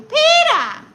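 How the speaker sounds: high, loud cartoon wife's yell; piercingly high mock holler; Lois. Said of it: "Lois, is that you?".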